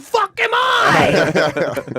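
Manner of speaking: high, loud outraged shout; mock indignant yell. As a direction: yell it slowly like someone outraged. Acts out Eddie Trunk reading book.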